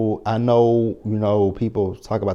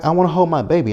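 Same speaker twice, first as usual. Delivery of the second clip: high, loud put-on pleading voice; mock whiny Anna; 8 semitones higher, 4 dB louder, much faster than usual. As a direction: beg in a shrill mocking mother voice. Voicing Anna's plea.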